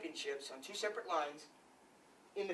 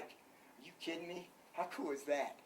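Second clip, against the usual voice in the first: choked up, verklempt; 10 dB quieter than usual. Retold or imitated. Coach recalling being moved by Coach Frazier, choked up and soft. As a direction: let the emotion catch in your throat. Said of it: "I get verklempt".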